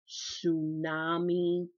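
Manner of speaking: tsunami drawn out slowly, savored; big word. Shown as a demonstration. Showing off a newly learned word, tsunami slow. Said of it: "Yes, I used a big word".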